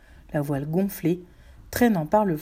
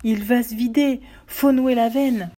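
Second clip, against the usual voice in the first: cri d'alarme, haut et fort; 5 semitones higher, 5 dB louder than usual. A narrator's reading. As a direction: crie l'urgence aux autres, en traînant la fin. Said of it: "un des matelots cria". (cri d'alerte d'un matelot)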